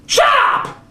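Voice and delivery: sudden very high yell; hammed-up self-interrupting shriek; mock snap. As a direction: snap shut up at yourself. Cuts himself off yelling.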